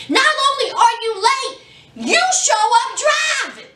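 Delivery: high scolding officer climbing to a shout; building scold. Retold or imitated.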